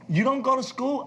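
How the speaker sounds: high, rising mock disbelief; incredulous. Executive sums up the working kid's life, incredulous. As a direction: act out a baffled adult's question.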